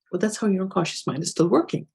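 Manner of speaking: ordinary delivery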